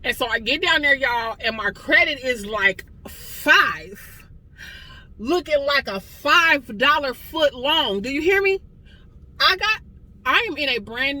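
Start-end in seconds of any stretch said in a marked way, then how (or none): 5.2-11.2 high and loud, delighted disbelief; overjoyed exclamation, pitch leaping up; joyful outburst. Real, not acted. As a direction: shout the good news to your viewers. Bursting with joy at the news.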